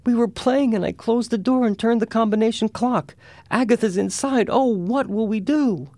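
put-on very high, panicked little girl's wail; crying child. A narrator's reading. Older sister confesses Agatha is locked inside, high crying panic. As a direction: wail it high like a frantic child, dragging the last words. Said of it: "May, was crying".

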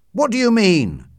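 high, loud demand for an answer; frightened shout. A narrator's reading. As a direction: demand it in alarm. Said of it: "shouted Utterson, now frightened too".

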